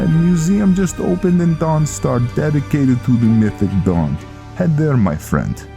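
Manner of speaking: helpful Viking giving directions. Acted. Viking sending Velen to the museum, animated.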